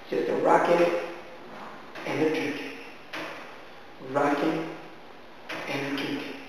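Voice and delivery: rocking and drinking refrain fading out. A narrator's reading. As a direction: let the chant die away. Refrain describing the master drinking.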